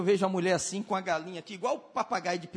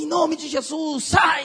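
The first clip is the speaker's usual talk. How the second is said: a higher, building command ending louder on sai; exorcism command; 6 semitones higher, 5 dB louder, slower than usual. Retold or imitated. Pastor commands the demon out of the maid, ending louder. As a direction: command it out with rising force.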